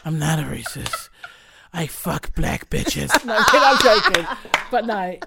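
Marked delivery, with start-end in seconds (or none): 0.1-3.1 low, gruff mock denial; slow down and go deep, deadpan; Liam Neeson voice. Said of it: "I saw a clip, and he denied".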